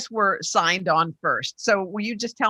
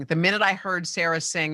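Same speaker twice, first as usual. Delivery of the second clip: ordinary talk, nothing marked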